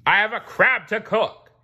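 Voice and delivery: high, loud regal threat; booming king. A narrator's reading. Neptune gloating to the sponge, high regal boom. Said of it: "said the king".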